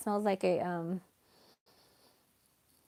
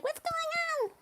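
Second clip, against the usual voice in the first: very high, soft voice for the cat; 6 dB quieter, slower than usual. Pretend. Voicing her anxious cat, very high and soft. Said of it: "He's like".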